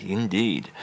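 ordinary talk, nothing marked

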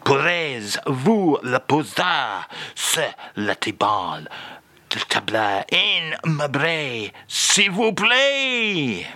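hammed-up French accent, slow; echoing French accent. [name] performs phrase again, slow French accent. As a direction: stretch it out dramatically in French accent. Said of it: "this is echoingly brilliant".

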